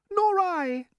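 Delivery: very high lady's voice; ladybird. A narrator's reading. Ladybird agreeing with the earthworm, high-voiced. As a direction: lift it into a high falsetto. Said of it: "the ladybird said".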